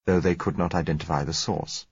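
ordinary delivery

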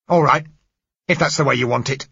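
loud, high, menacing; grim threat. Acted. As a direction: warn him before you strike. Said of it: "stared back grimly".

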